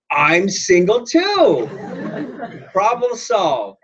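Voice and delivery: high, very slow hammed-up announcement; comic triumph. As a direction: draw out each word in mock delight. Suitor's comic triumphant offer.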